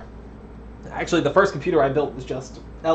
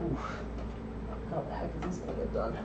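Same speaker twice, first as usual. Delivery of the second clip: usual speaking voice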